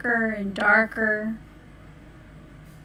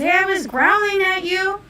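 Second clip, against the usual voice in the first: indignant wolf; 9 semitones higher, 3 dB louder than usual. Acted. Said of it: "the wolf says".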